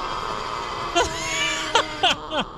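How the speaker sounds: shaky half-scared laughing; nervous laugh. Real, not acted. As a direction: laugh it off after a fright. Startled by a jump scare.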